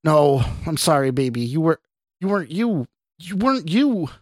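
high, tearful pleading; distraught apology. A narrator's reading. Scott apologises to his dying girlfriend, distraught.

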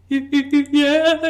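high, strained "Yeah" holding back sobs; fighting tears. Retold or imitated. Crying daughter answering her dad at the door, holding back tears. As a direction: choke out a brave yes. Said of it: "just, like, fighting the tears".

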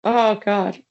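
worn out from laughing, slow; breathless after a laughing fit; after laughing hard. Real, not acted. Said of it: "My face hurts from laughing".

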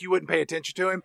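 usual speaking voice

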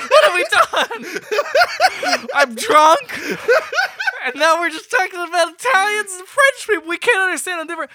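high, loud, helpless laughing talk; real cracking up while lamenting; laughing dismay. Real, not acted. Host lamenting a terrible podcast start, laughing hard. Hosts crack up at their blunder.

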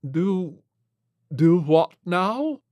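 high, fussy gentleman; dazed prim gent. Acted. Flintlock voiced.

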